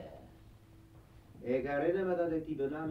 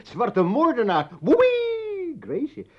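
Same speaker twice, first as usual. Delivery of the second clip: much higher and louder, whooping; mocking echo; 13 semitones higher, 15 dB louder, slower than usual. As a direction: throw her words back at her. Herhaalt haar woorden spottend. (spottend nabauwen)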